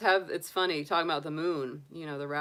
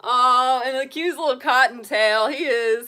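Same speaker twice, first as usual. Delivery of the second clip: loud, high gushing over the bunny; 7 semitones higher, 14 dB louder than usual. Real, not acted. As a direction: burst out adoring the little cottontail. Gushing over her pet rabbit.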